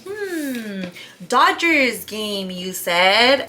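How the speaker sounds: intrigued hmm. Retold or imitated. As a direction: perk up at the offer, coy. Suddenly interested in Dodgers offer.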